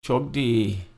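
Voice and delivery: light, friendly goodbye; casual goodbye. Shown as a demonstration. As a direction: toss off a breezy chok di. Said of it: "you can also say to somebody".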